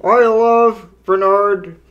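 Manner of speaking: slow, put-on robot puppet voice; hammy, slow robot declaration; Tito Servo. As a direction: draw it out as a cartoon robot. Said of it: "I'll do Tito Servo".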